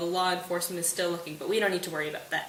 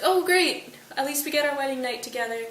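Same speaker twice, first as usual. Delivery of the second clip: mock delight; 5 semitones higher than usual. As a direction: play the thrilled young bride. Juliet cheered by the wedding night.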